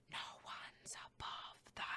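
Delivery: soft, breathless whisper; whispered song line. Retold or imitated. Reviewer copying the song's whispered intro line, a hushed whisper. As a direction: hush it like the track's creepy intro.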